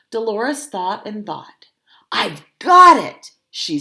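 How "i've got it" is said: sudden idea. Acted. Dolores finds the idea.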